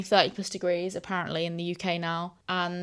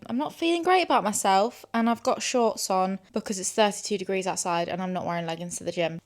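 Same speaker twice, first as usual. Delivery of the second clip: normal talking voice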